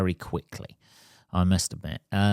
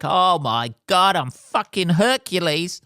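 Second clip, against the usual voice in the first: put-on cocky teen; 8 semitones higher, 4 dB louder, slower than usual. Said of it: "they're like".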